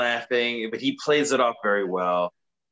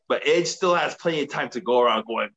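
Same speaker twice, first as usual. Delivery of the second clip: ordinary delivery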